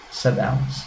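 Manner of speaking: ordinary delivery, nothing marked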